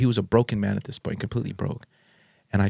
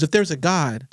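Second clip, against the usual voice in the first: higher-pitched heated challenge, mildly acted; retold raging; 6 semitones higher than usual. Father challenging his son about God, mock raging. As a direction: imitate someone demanding answers, voice raised in pitch. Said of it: "he was just raging".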